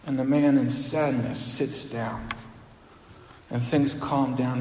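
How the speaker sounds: normal talking voice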